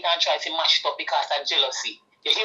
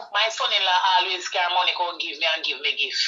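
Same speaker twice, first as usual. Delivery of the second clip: ordinary talk, nothing marked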